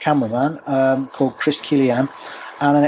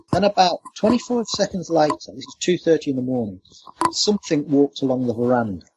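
usual speaking voice

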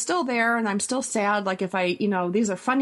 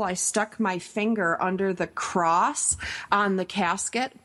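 normal talking voice

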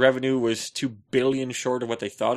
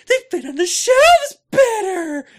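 high mocking voice, slower; whiny mock of Erickson, way up high; high, slow mock voice of Erickson's excuse; 10 dB louder, slower than usual. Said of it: "Erickson's like".

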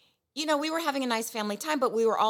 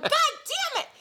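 much higher exclaimed curse; retold curse; slower than usual. Swearing on the sidewalk after the bottle breaks, high yelp. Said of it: "And I went".

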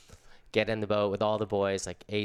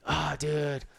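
stoked surfer; 5 semitones higher than usual. Retold or imitated. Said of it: "Ace Buchan's like".

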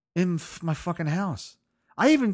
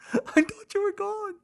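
higher crying wail. Retold or imitated. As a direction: let "I thought you were gone" crack way up into a thin wail. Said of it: "So I cried".

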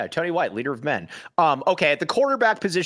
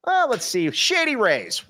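ordinary talk, nothing marked

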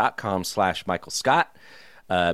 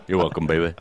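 [name] voice; 5 semitones lower, much faster than usual. Retold or imitated.

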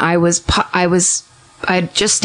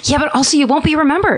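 blunt punchline; 8 semitones higher, faster than usual. Real, not acted. Punchline answering fearful people.

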